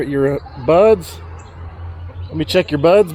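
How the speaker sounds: usual speaking voice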